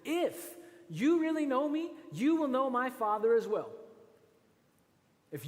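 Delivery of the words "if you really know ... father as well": higher, louder emphatic Jesus; Jesus doubling down to disciples, louder. Acted. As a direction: press the point, pitched up. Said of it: "He says".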